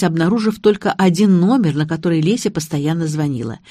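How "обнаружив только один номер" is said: usual speaking voice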